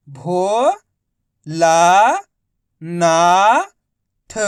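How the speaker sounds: भो, ला, ना, थ each held long, with pauses; syllable by syllable. Shown as a demonstration. Teacher sounding out a word, भो ला ना थ drawn out. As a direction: chant भो, ला, ना, थ separately, gliding upward. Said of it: "direct मात्रा का उच्चारण करके".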